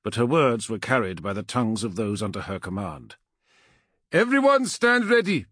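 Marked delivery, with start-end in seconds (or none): 4.1-5.5 high, loud captain's order; commanding call. A narrator's reading. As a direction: call the whole company to readiness. Captain calls troops to readiness.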